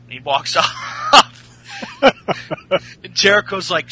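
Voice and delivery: ordinary delivery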